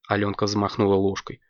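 ordinary delivery

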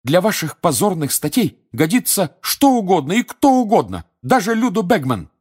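loud, high, indignant outburst; angry. A narrator's reading. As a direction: hurl the accusation. (гневно)

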